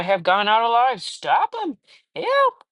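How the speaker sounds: high, loud panicked shout rising to a shriek; panicked yell. Acted. Reader voicing a man screaming as the mine collapses. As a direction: break into a high scream for help.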